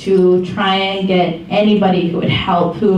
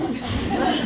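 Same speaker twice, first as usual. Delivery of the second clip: breathy laugh; 2 semitones higher, 10 dB quieter than usual. Real, not acted. Laughs at own joke.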